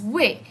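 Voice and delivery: "wei" in a short falling tone; cuarto tono. Shown as a demonstration. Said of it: "este cuarto tono". («wei» en cuarto tono, corto y descendente)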